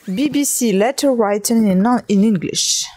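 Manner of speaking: English read out with a French accent. Real, not acted. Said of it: "Excuse me for my accent English".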